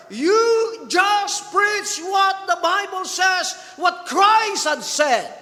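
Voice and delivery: high, ringing preacher's call, slowing to a shout at the end; fiery preaching. Acted. Preacher urging the congregation, rising to a shout. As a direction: drive it home to the congregation.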